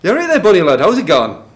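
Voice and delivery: loud, high regional British accent greeting; broad back-home accent. Shown as a demonstration. Testing dictation with a greeting from back home, broad local accent. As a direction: call it out in a thick hometown accent. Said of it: "talk like this back home".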